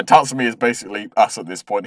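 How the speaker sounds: ordinary talk, nothing marked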